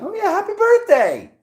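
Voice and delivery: very high, loud happy wish; singsong birthday cheer. Real, not acted. Reader wishing Pisces viewers a happy birthday, high and bright. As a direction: call it out like a party greeting.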